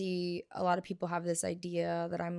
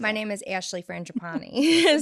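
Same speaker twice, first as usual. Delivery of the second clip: heavy New Jersey accent, louder; 7 dB louder than usual. Shown as a demonstration. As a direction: say her full name the Jersey way. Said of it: "say it in your New Jersey accent".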